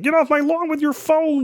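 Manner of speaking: high-pitched mock yell of an old crank; yelling old guy. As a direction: play the old man scolding kids. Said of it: "old guy yelling at the, the kids".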